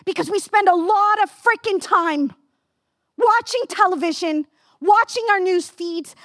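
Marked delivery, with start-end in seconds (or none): none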